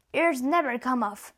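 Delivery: usual speaking voice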